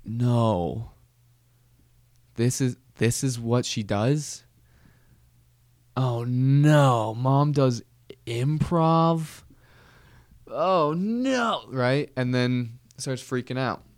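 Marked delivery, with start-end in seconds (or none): 0.0-11.6 a lightly voiced baby in dismay, rising at the end; mock baby panic. Baby realizing its mom does improv, mock-horrified wail. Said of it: "being like".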